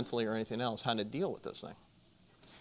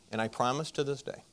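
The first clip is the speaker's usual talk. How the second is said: ordinary delivery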